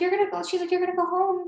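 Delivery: high, incredulous mock girl voice; surprised girl. Girl surprised the speaker is leaving, high mock voice. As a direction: echo her surprise up high.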